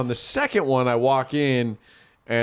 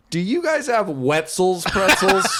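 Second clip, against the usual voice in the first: laughing fake shopper; 6 semitones higher than usual. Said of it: "I'm like".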